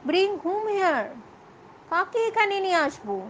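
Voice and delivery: high, startled question; baffled. Acted. Father confused by daughter's demand, startled. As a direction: ask as if thrown completely. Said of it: "অবাক হয়ে বলছে".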